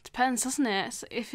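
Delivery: ordinary talk, nothing marked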